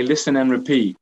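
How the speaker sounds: ordinary delivery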